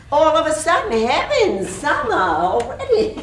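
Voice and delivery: high, loud breathless gush with a laugh; gushing exclamation. Acted. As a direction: gush about the sudden summer. Mother gushing about summer.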